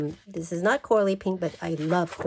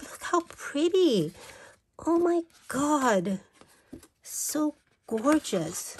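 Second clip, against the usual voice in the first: swooning; 7 semitones higher, slower than usual. Real, not acted. Swooning over paper.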